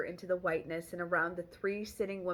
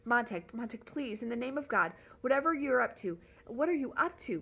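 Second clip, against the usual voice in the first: pleading; 6 semitones higher, 3 dB louder than usual. Acted. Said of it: "pleaded Faber".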